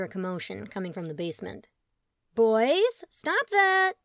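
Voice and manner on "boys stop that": high, loud scolding call; mother yelling. Acted. Rita calling down to her fighting sons, high and loud. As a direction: stop the boys fighting downstairs.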